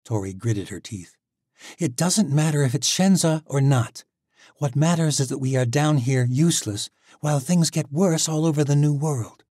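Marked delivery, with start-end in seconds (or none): 1.8-9.4 put-on higher, louder, insistent young woman; Tori's frustrated voice. Tori snaps at Misha about wasting time, raised and insistent. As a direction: push back hard, she's fed up.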